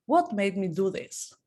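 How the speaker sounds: ordinary delivery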